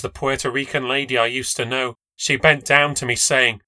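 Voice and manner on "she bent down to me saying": plain reading voice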